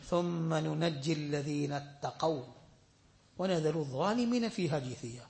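rapid chanted Arabic recitation; quick Arabic recitation. Acted. Quoting the hadith to the congregation, quick Arabic recitation. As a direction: rattle off the Arabic hadith text.

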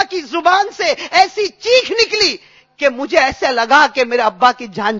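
high, loud, fervent sermon delivery; preaching at full force. Acted. Preacher stirring the congregation with a story of weeping, preaching at full force. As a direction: preach it out with swelling passion.

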